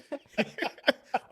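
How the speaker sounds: laughing fit at his own story; high burst of laughter. Real, not acted. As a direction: crack up for real.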